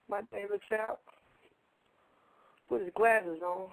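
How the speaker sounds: ordinary delivery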